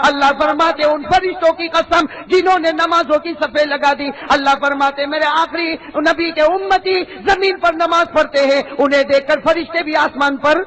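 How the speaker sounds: loud, high preaching voice; booming sermon. Acted. Preacher booming to the congregation at full force. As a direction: preach it out to the crowd.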